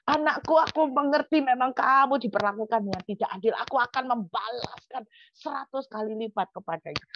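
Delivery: hammed-up vow of a protective father; God taking sides. God defends the wronged preacher, impassioned imagined vow. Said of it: "Saya pikir Tuhan bakal bilang begini".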